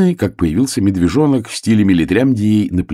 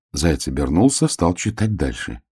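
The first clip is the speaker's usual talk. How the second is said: ordinary delivery, nothing marked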